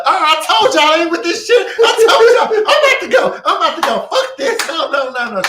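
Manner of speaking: high, shouted hysterical panic; hammed-up screaming refusal to stay; dramatic freak-out. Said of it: "it's just so dramatic".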